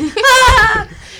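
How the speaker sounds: high burst of laughter; loud laugh. Real, not acted. Reacting to mom peeing car.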